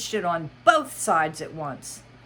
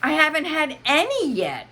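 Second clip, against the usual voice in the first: put-on girl's voice; 11 semitones higher, 6 dB louder than usual. Alice replies.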